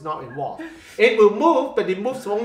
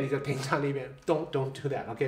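ordinary talk, nothing marked

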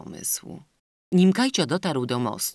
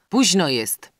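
louder, for everyone to hear; said aloud; 4 semitones higher, 7 dB louder, slower than usual. A narrator's reading. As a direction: raise the voice for the others to hear. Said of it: "dodał głośno".

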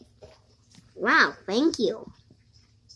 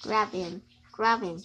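quiet grab him, low and soft; soft urging; 5 semitones lower, 7 dB quieter than usual. Acted. Kid quietly urging a partner to grab the enemy, soft. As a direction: murmur "grab him" under the breath.

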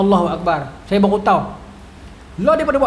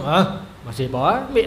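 gruff brush-off; 6 semitones lower, 4 dB quieter than usual. Acted. Husband to wife who says thanks, lightly gruff.

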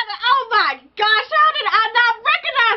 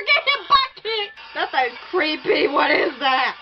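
usual speaking voice

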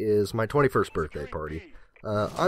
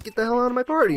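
squeaky goofy order; high mock kick-out; 12 semitones higher, much faster than usual. Gamer ordering friend out, high and goofy. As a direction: shoo him out in a high silly voice. Acting out his refusal.

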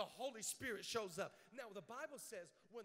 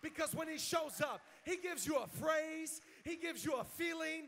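loud, high, shouted preacher's crescendo; full-force preaching; 5 semitones higher, 12 dB louder than usual. Real, not acted. Preacher rallying a church to give thanks, shouted preaching. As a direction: drive each line home to the congregation at full volume.

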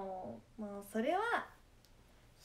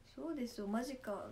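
ordinary talk, nothing marked

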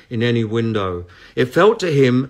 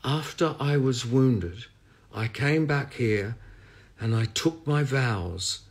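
slow, softer, fading confession; small voice; 6 dB quieter than usual. A narrator's reading. The priest admits his past to the medium in a small, slow voice. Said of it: "In a small voice he said".

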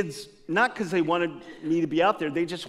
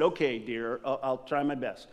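meek reply; 5 semitones lower, 7 dB quieter than usual. Retold or imitated. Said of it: "I said".

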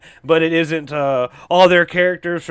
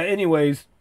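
ordinary talk

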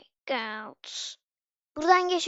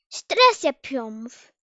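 mocking; 8 dB louder, much slower than usual. Real, not acted. Mocking the stressed character.

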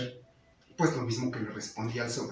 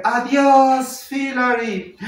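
high singsong greeting, drawn out; lady calling out; 9 semitones higher, 6 dB louder, much slower than usual. Retold or imitated. A lady greets a passing cyclist, high drawn-out call. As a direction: call out long in a higher woman's voice. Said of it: "ahora una señora le dice".

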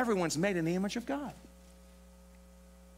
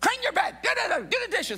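high, shrill nagging parent voice; mocking a nagging parent, much higher. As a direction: whine it like a nagging mum. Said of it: "when your parents nag you".

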